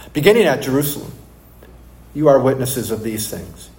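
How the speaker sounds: scripture reading. Acted. Pastor reading Luke to the congregation, measured scripture reading. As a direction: read the passage with reverence. Reads scripture aloud before sermon.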